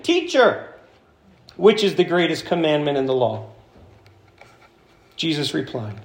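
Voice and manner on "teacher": high-pitched call to a teacher. Acted. Acts out the expert addressing Jesus.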